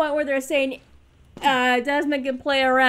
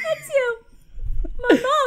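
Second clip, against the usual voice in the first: mock breakdown; 8 semitones higher, faster than usual. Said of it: "Ezio breaks down".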